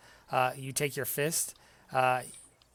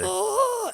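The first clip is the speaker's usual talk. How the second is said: loud high yelp of pain; pained cry; 7 dB louder than usual. Acted. The felled giant howls when asked about weather, loud high yelp. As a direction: wail in agony.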